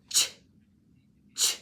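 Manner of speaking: isolated ch digraph sound, twice; phonics sound ch. Shown as a demonstration. Teacher to pupil, sounding out ch. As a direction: say just the sound ch, crisp and short. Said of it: "So the first sound is".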